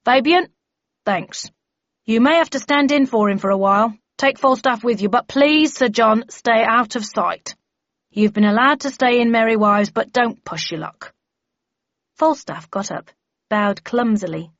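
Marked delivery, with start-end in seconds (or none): 0.0-11.1 firm, raised briefing voice; bellman giving assignments. Acted. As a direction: hand out orders to the team.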